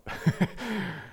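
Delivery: short amused laugh; a little real chuckle after the punchline. Real, not acted. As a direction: laugh at the line you just said. Speaker laughs at own line.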